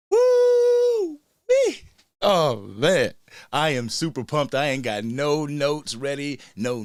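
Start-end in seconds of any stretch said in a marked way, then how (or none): 0.1-1.8 high, loud celebratory whoop; excited whoop. Real, not acted. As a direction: let out a long, high "woo-wee". Celebrating the team's win.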